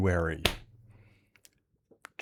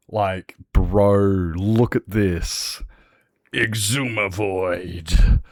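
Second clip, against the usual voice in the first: drawn-out hype; 4 dB louder, much slower than usual. Real, not acted. Showing off new flag to chat.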